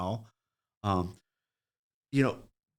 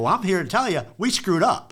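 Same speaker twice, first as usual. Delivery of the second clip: louder and higher, proud declaration; emphatic admission; 9 semitones higher, 7 dB louder than usual. Retold or imitated. Mechanic insisting to the doubtful customer, loud bold confession. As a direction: announce it boldly. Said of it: "I go".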